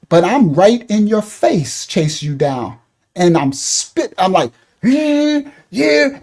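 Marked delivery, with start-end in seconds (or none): 4.8-6.2 high, drawn-out mock screech; pretend yell. Harasser right in your face, high mock yell. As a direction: mimic yelling without full volume. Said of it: "and I'm yelling in your face".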